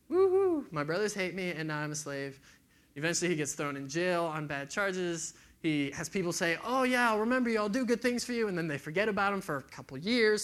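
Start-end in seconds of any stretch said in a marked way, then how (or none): 0.1-0.7 much higher and louder mock whoop; sarcastic cheer. Joseph mock-cheering his own bad luck, sarcastic whoop. 6.6-8.5 higher, louder, offhand reassurance; breezy empty promise. Retold or imitated. Jail mates promising Joseph favours, breezy and hollow.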